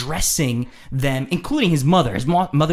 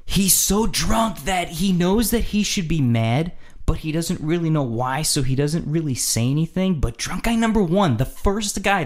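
ordinary talk, nothing marked

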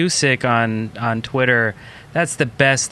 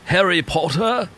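put-on British accent; 4 semitones higher than usual. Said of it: "very horrible at British accents".

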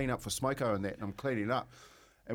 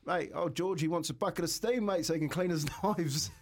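put-on eager young self; 6 semitones higher than usual. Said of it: "I said".

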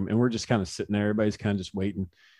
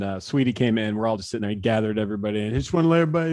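normal talking voice

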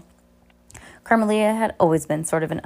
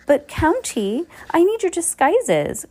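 much higher, slow whine; whiny spoiled girl; 11 semitones higher, 4 dB louder, slower than usual. A narrator's reading. Spoiled girl pleading with Olaf, high whine. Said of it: "Carmelita whined".

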